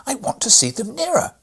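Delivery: crying out. A narrator's reading. Fred demands from his godfather, high loud cry. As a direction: insist loudly like a boy. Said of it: "cried Fred".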